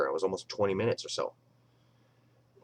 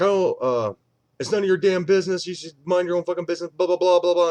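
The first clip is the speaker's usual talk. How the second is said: loud, higher mocking rant; angry retort; 9 semitones higher, 6 dB louder than usual. The angry dog owner retold, a loud mocking rant. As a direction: spit it back, dismissive and mad. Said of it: "he was like".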